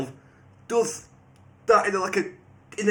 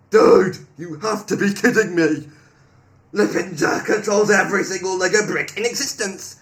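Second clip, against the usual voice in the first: very loud, emphatic read-out; mock outrage; 3 semitones higher, 10 dB louder than usual. Player reading the AI's reply to viewers, loud mock outrage. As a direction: belt it out in over-the-top disbelief.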